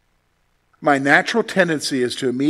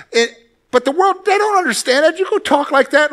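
exclaiming; 11 semitones higher, 3 dB louder than usual. Real, not acted. Preacher's rising exclamation.

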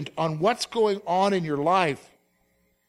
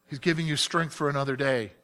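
ordinary talk, nothing marked

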